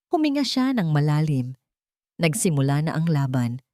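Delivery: usual speaking voice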